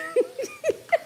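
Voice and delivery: bursting high laugh. Real, not acted. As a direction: laugh out at the comment. Laughs at chat.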